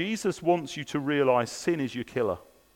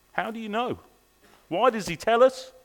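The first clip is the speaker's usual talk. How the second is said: repeated, pitched higher and lighter; higher question; 6 semitones higher than usual. Shown as a demonstration. Preacher repeating the question to the congregation, higher. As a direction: lift "How do you know?" up high like a genuine puzzle. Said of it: "So next question".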